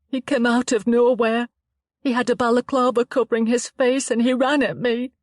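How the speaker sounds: put-on a frightened girl telling it haltingly; shaken young woman Chloe.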